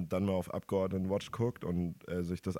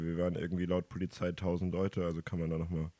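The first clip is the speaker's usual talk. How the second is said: normal talking voice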